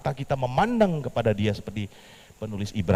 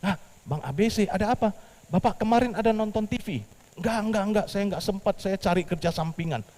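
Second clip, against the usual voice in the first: lightly voiced Petrus; 12 semitones higher than usual. Petrus talks to bank.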